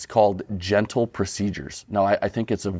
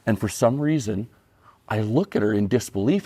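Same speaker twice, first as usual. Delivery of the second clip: normal talking voice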